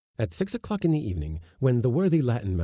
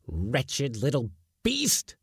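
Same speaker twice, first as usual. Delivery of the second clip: angry mutter; 5 semitones higher, slower than usual. A narrator's reading. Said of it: "he muttered".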